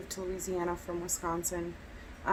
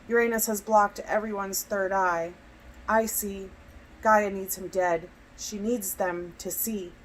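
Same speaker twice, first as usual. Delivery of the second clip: deliberate spoken-word delivery, louder than chat; poetry reading; 5 dB louder than usual. Acted. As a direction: recite in short phrases with pauses between. Reads own poem aloud.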